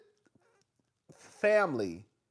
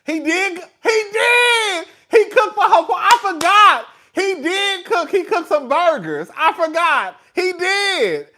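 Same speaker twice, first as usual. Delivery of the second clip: much higher and louder, near-shouting recall; excited realisation; 10 semitones higher, 11 dB louder, slower than usual. Real, not acted. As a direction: blurt it out as it all comes back. Suddenly remembers son cooked burgers.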